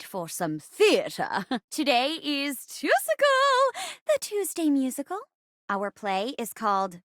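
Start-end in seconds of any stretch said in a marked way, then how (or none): 1.7-5.2 musical show announcer. Acted. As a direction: stretch the last word high like a musical number. Host announces the musical day.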